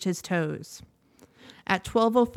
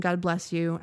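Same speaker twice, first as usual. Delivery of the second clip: normal talking voice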